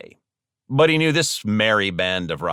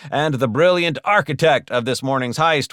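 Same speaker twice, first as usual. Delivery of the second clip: ordinary delivery, nothing marked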